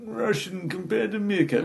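high, hammed-up Russian meerkat voice; Russian accent. Comic showing what the routine was about, Russian accent. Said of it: "sort of accent".

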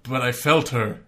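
Darth Vader with a bad Irish accent; clumsy Irish-accented Vader impression. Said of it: "could you imagine an Irish Darth Vader".

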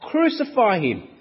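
high, loud shout of a mob; crowd shout. Acted. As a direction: yell it like an angry crowd.

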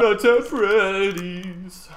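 very slow, drawn-out dramatic chant. Retold or imitated. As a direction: stretch every word like a theatrical rock singer. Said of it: "And he goes".

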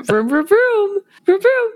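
loud silly engine noises, vroom; car noises. Pretend. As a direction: rev it out like a cartoon car. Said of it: "giving us car noises".

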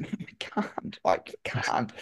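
breathless whisper, spent and pleading; exhausted whisper. Retold or imitated. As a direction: whisper it like you have nothing left. Exhausted rider refusing to sprint.

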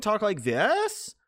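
THIS stretched and swung sharply upward; mocking upspeak on THIS; mock uptalk. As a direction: turn THIS into an exaggerated drawn-out question. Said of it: "inflection does not rise at the end".

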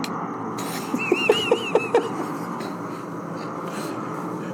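long wheezing laugh; real breathy laughing fit, trailing off; laughter. Real, not acted. Hosts hearing the producer got thrown in a lake, wheezing laughter.

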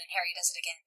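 normal talking voice

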